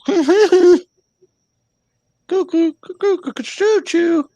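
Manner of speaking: high, hooting cuckoo sounds; cuckoo call. Pretend. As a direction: squeak it like a cuckoo clock. Joking about going cuckoo.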